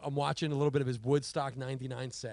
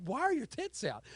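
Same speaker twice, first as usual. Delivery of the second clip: incredulous; 11 semitones higher than usual. Retold or imitated. Mock outraged reaction.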